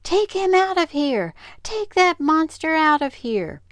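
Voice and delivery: high, loud panicked yell; shrill frightened yell at the dog. Acted. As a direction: scream it from up on the chair. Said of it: "she jumped up on a chair and yelled".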